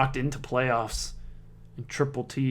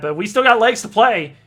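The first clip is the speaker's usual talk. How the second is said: loud, high and upbeat; rally the viewers for more play; raised sportscaster voice, pushing on; rousing commentary; 7 semitones higher, 11 dB louder than usual. Real, not acted. Rallying for the next leg.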